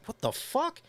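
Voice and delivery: high-pitched, incredulous mimicry; imitated shocked reaction; mock bafflement. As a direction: squeak it out in hammed-up disbelief. Acts out listeners' baffled reaction.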